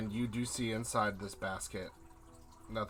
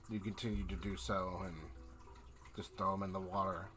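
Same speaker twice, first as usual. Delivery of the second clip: scene narration; 5 dB quieter than usual. A narrator's reading. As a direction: let the sunset unfold gently, savouring each image. GM describes sunset by water.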